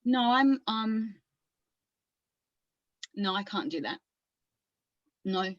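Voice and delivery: put-on halting, subdued retreat, Australian accent; lightly played patient, hesitantly asking to stay; withdrawn character. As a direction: back down in broken phrases with long pauses.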